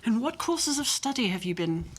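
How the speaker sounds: posh West End English; Jamie Saint Andrews. Acted. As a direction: chat politely in a posh West End accent. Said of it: "my posh West End accent".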